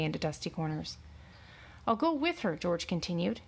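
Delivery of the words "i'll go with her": high-pitched desperate plea; plead, pitch jumping up in desperation. A narrator's reading. Farmhand begs to go with his horse, desperately. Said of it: "his voice taking on a tone of desperation".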